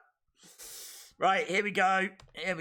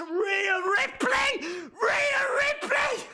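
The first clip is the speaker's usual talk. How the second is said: ecstatic cheer; 13 semitones higher, much slower than usual. Real, not acted. Streamer greeting Rhea Ripley's entrance, screaming high. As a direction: scream the name like an arena crowd.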